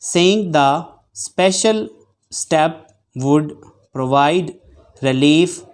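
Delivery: very slow, phrase by phrase with pauses; steady, deliberate dictation read; dictation at eighty words per minute. Shown as a demonstration. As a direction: read it out for shorthand students to take down. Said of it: "मैं exact eighty की speed बोलूँगा".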